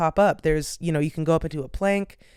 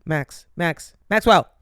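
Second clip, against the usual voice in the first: calling out; 3 dB louder, slower than usual. Real, not acted. Calling the dog.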